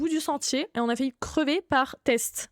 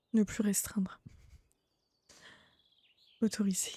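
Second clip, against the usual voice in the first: breathy whisper, reading the option under her breath; whispered to herself; 3 semitones lower, 7 dB quieter than usual. Real, not acted. Streamer whispering a menu option while unbanning a viewer. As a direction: whisper the menu word to yourself.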